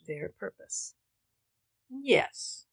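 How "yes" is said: higher, defiant yes; the father snaps back at his daughter; truculent cry. A narrator's reading. Father to daughter, a truculent cry. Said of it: "he cried truculently".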